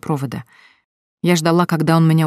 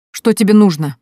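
a touch of nervous edge, louder and higher; 5 semitones higher, 6 dB louder than usual. Acted. Lilya answering her ex Slava's call, mildly nervous and sharp. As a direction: snap the question out tensely. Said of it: "нервно спросила я".

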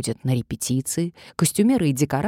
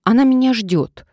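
firm, louder assured claim; confident; 8 semitones higher, 8 dB louder than usual. A narrator's reading. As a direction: say it like you belong there. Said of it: "как можно уверенней".

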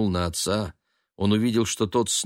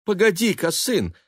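high-pitched startled mother; alarmed exclamation; 10 semitones higher, 3 dB louder than usual. A narrator's reading. Mother stepping back to inspect her son, alarmed. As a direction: stop him with a high cry. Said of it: "воскликнула Элиза".

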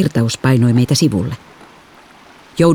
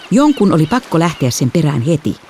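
ordinary delivery

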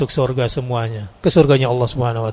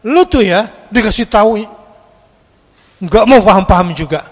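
sharp, louder scolding of a stubborn listener; scolding judge; 7 semitones higher, 6 dB louder than usual. Retold or imitated. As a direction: lecture them with contempt. Preacher mocks harsh judging talk.

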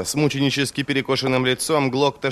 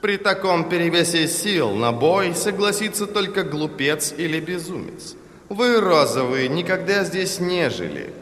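raised, imperious lecturing voice; commanding envoy, pitched up; sonorous, haughty ambassador; 7 semitones higher than usual. A narrator's reading. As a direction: deliver the ultimatum with lordly scorn. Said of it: "звучный, властный, такой же надменный".

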